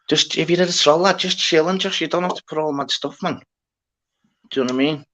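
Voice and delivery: just chill, lad, exasperated; exasperated plea. Real, not acted. Streamer pleads with a chat troll, exasperated. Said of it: "telling off a troll".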